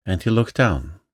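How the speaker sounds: usual speaking voice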